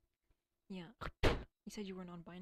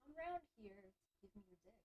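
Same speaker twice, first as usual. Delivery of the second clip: mock voice; 9 dB quieter, faster than usual. Mocking voice, dick market.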